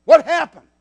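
husband bristling at the stranger. Retold or imitated. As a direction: snap it out, suspicious. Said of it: "And boy, he bristled".

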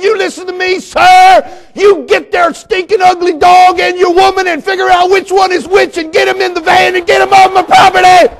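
high, loud yelling at full force; furious tirade. Retold or imitated. Pastor ordering dog owner off the property, high furious shout. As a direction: blow up and shout him off the place.

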